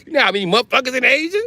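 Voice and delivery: normal talking voice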